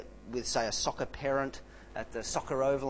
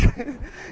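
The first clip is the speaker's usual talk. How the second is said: short laugh; 8 semitones higher, 5 dB louder than usual. Real, not acted. Laughs at his own impression.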